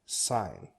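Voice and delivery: sign, said slowly and clearly as "sine" with a silent g; teacher modelling sign slowly, the g left silent; careful pronunciation. Shown as a demonstration. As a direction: spell out the sound of sign, unhurried. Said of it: "It's pronounced".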